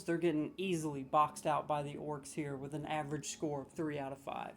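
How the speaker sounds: ordinary delivery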